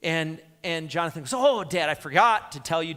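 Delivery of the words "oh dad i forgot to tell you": son's excuse. Acted. Son voiced making excuse to father.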